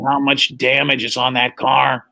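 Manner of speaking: slow robotic monotone of a voice box; electrolarynx voice. Retold or imitated. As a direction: imitate a man speaking through a throat device. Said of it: "it's one of those voice things".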